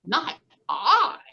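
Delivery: laughing little pig refusal; playful pig saying no. Acted. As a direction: say it like a giggling pig. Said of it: "oinked the pig".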